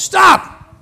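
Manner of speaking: high, loud yelled stop; woman on a plane yelling at the man reading to her; hollers stop. Retold or imitated. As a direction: cut him off with a yell. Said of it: "She hollers".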